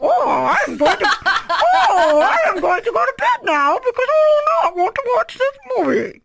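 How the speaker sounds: high wailing mock sob; whiny fake crying. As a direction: whine it like a crying kid. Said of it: "I was like".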